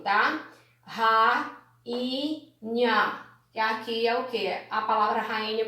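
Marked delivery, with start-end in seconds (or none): 0.8-3.3 RA, I, NHA with the I standing alone; syllable by syllable. Shown as a demonstration. Teacher showing the i alone, separated syllables. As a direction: pause round each syllable of rainha. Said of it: "O i vai ficar sozinho".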